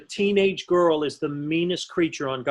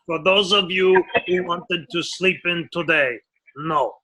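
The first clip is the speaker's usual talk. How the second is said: mildly lowered captain on the PA; captain impression; 2 semitones lower than usual. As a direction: announce it like the ship's captain, lightly lowered. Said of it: "So this would go on constantly".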